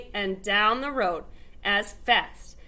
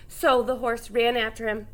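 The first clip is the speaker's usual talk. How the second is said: plain reading voice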